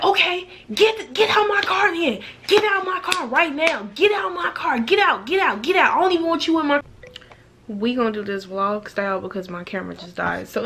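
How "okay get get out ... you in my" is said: high, strained yell to get out; imitating the driver screaming get out; mock furious yelling. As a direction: shout them out of the car, voice pitched way up.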